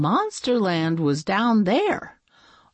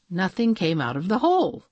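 ordinary delivery, nothing marked

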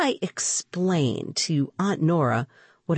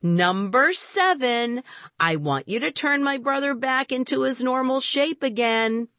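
loud incantation; 9 semitones higher than usual. A narrator's reading. Boy commanding the number seven on his cape, loud incantation. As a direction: intone the wish in a raised pitch. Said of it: "I said loudly".

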